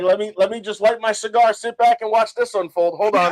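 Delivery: settling in to enjoy the drama; gleeful onlooker. Retold or imitated. Brother imitating Pedro watching the standoff, mock gleeful. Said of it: "He's like".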